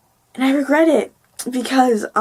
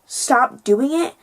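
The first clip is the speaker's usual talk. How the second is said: ordinary talk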